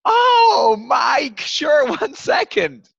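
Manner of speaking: very high, loud mock-delighted cry that turns to a slow laugh; hammed-up receptionist, suddenly all welcome; eager receptionist. Receptionist recognizes the name and puts him through, hammed-up delight. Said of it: "And they're like".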